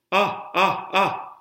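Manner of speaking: open, high-pitched "Ah" sounds. Shown as a demonstration. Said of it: "stay in the ah space".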